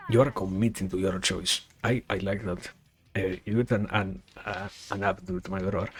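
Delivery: normal talking voice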